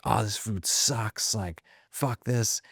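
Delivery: a lightly voiced sloppy drunk, slow and soft; the drunk friend sneering at the plane food, mildly imitated; imitating drunk friend. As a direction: slur it a touch, slow and dismissive.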